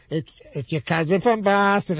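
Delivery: slow, drawn-out mock Boston accent. Mocking Boston accent, muttered to himself in a bathroom stall. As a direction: drag out a mocking Boston "cousin from Boston". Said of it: "I'm totally, like, making fun of, like, the, the Boston accent".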